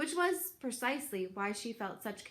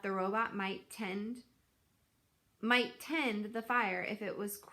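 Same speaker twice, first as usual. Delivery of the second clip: plain reading voice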